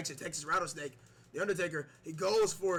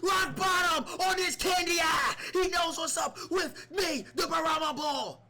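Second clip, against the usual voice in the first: high, loud hammed-up wrestler trash talk; The Rock; 5 semitones higher, 4 dB louder than usual. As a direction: shout it like a cocky champion on the mic. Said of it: "The Rock, what do you have to say about it?".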